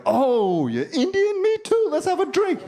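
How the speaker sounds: high-pitched delighted squeal; giddy high mock joy; excited high squeal. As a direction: squeal it like finding a long-lost cousin. Excited mock reply to Larry.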